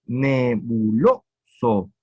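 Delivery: "Nebuloso" slow, lo stressed; slow scansion demo. Shown as a demonstration. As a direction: stretch out "Nebuloso" and hit lo. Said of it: "en lo está el acento".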